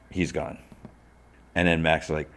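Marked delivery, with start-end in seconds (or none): none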